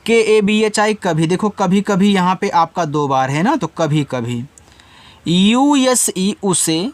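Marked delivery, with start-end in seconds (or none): 5.3-6.9 slow letter-by-letter spelling; teacher spelling out a word for students. Shown as a demonstration. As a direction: spell out each letter slowly and deliberately. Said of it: "यू से ऊ बन गया".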